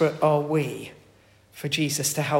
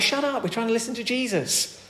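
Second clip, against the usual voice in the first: rebuking; 6 semitones higher than usual. Retold or imitated. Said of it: "Those who led the way rebuked him".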